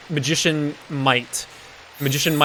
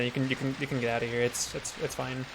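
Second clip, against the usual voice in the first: much softer, halting dismissal; reluctant consent; 13 dB quieter than usual. Acted. Satan letting the magicians leave, quietly awkward. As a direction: trail into an awkward murmur.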